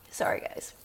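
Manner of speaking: voiceless whisper, voice giving out; breathy whisper, no voice left; losing her voice. Real, not acted. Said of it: "my voice is gone".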